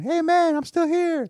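high, sing-out call; comic high-pitched reminder from afar; calling from the background. Acted. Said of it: "that's in the background going".

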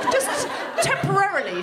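high, light-voiced official, lightly done; mock-airy civil servant reassuring; breezy official. Home Office clerk brushing off the caller, lightly mock breezy. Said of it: "she said".

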